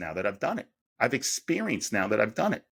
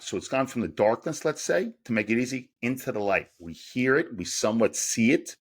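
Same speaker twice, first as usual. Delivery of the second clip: ordinary talk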